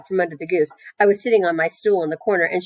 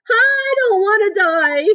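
very high, loud, drawn-out shriek; screamed; 6 dB louder, much slower than usual. A narrator's reading. Wilbur throwing himself to the ground, screaming. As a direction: scream it out in full tantrum. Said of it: "screamed Wilbur".

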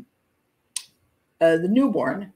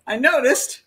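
mock deadpan quote; 4 semitones higher, 4 dB louder than usual. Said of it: "I love the way they just say it".

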